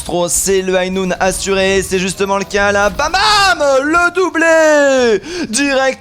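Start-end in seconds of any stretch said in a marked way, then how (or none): 2.9-5.9 loud hyped shout over a kill; excited shout. Real, not acted. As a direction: yell out the double kill.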